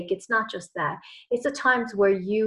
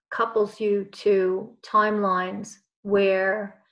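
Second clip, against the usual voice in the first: ordinary talk, nothing marked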